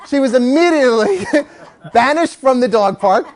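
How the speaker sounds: laughing. Real, not acted.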